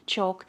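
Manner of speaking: choking cough, then a strangled word; coughing fit. Real, not acted. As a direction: cough hard and sputter out the word. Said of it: "I was choking there".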